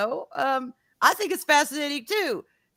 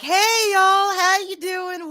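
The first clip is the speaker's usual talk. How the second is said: cheery greeter mom; 8 semitones higher, 7 dB louder, much slower than usual. Retold or imitated. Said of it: "my mom is like".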